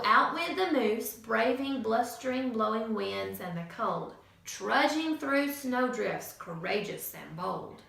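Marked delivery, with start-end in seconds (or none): none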